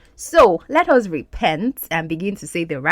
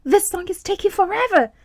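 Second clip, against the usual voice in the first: high-pitched acted complaint, louder on the repeat; raised, high voice quoting a complaint; quoting exasperated Zoe; 10 semitones higher, 4 dB louder than usual. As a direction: act out Zoe's impatience, pitched well up. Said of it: "Zoe saying".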